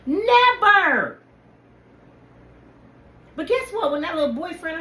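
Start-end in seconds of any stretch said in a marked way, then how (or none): 0.1-1.2 emphatic loud never. Real, not acted.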